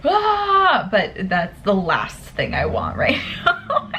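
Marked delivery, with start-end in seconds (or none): 0.0-0.9 high frustrated yell; frustrated shriek. Real, not acted. Student venting after the test, frustrated yell. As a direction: let out a pent-up high yell.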